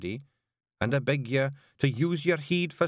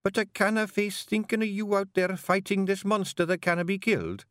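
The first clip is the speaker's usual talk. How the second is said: tearful plea; 11 semitones higher than usual. Acted. Jeanie begs Rob not to fight the monster, tearfully. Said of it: "beginning to cry".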